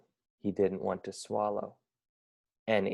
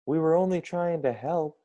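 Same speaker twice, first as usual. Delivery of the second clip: put-on higher pleading kid voices; 6 semitones higher than usual. A narrator's reading. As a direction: plead innocently. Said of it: "said Joey and Schmoey".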